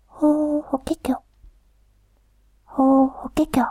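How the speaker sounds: whispered warbler song; bush warbler call. Acted. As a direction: sing it out like a warbler. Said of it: "ウグイスがとても美しい声で鳴きました".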